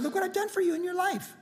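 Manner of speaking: high, distant voice of God; lightly voiced God recounting a gift; voice of the Lord. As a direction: speak down from heaven, gently. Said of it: "But when he said".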